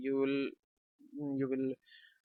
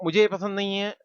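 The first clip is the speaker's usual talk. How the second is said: raised, higher "मुझे ये पसंद नहीं है" with stress on "ये"; louder displeased quote; 6 semitones higher, 6 dB louder than usual. Retold or imitated. Speaker acting a displeased person objecting. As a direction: voice the complaint up high and louder. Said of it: "तो".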